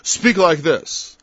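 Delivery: preacher showing how to speak; slow emphatic demo. Shown as a demonstration. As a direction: lay out Speak like this slowly and firmly. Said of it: "This is the kind of talk you should have".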